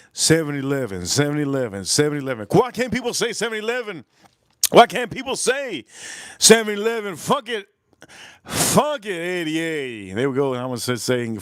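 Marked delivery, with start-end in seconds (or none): 2.5-10.1 high-pitched comic complaint; mock exasperation. Streamer griping that people can't say seven eleven, mock exasperated. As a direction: whine it high, in mock frustration.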